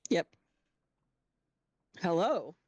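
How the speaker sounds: ordinary delivery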